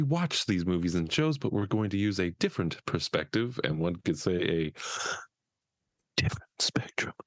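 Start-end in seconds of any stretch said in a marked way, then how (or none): 6.2-7.1 hushed, breathy-voiceless show name; stage whisper for the show's name; whispered title drop. Acted. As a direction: whisper it like a reveal.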